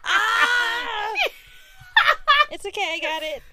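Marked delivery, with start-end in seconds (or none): 0.0-1.1 surprised shout, high and loud; a startled laughing yelp. Real, not acted. Mic stand falls over.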